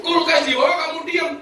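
indignant husband. Acted. Husband scolds his wife over the stolen TV, outraged.

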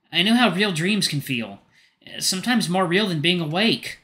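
kind, concerned friend; Rofi's voice. Acted. Rofi comforts friend.

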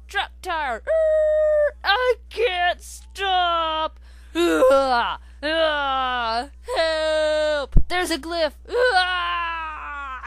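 panicked high screaming; burning scream. Acted. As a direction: scream in terror as you crash. Crash, panicked driver.

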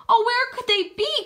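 high, worried storybook lament; tearful cry. A narrator's reading. Miss Lily searching for the lost mouselings, worried cry. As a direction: wail the question, lightly acted. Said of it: "cried Miss Lily, wiping away her tears".

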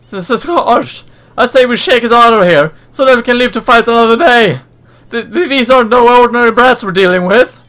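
high, loud cowardly villain; hammed-up goon retreat. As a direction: play the scheming thug backing off. Villain voiced in game dialogue.

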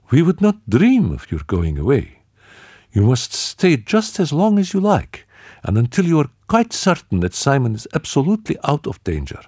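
high, earnest woman's voice; eager hostess. Acted. Marie-Lou urges her guests to stay, high and earnest. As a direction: insist warmly that they must stay.

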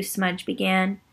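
ordinary delivery

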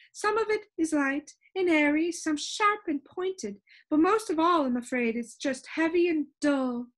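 high-pitched, lively storybook shopkeeper; high voice of a proud expert; Soundkeeper character. A narrator's reading. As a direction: explain it eagerly in a high character voice.

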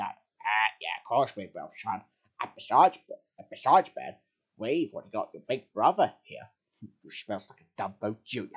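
low-pitched father character; reassure, then slide into a dry tease; teasing dad. Acted.

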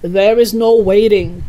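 loud, impatient exclamation; excited father overriding his child; worked-up cry. A narrator's reading. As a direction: brush the objection aside loudly. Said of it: "cried Mister Cranky, working himself up".

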